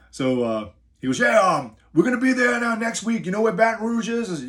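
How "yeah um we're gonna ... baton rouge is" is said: high, loud New York Bronx caller voice; heavy Bronx accent. Retold or imitated. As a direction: imitate a brash Bronx security boss on the phone. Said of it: "Very heavy New York Bronx accent".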